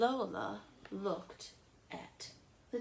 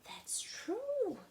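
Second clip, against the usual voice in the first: little sister agreeing. Acted. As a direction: concede like a curious small girl. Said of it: "said Lola".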